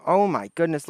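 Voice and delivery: ordinary talk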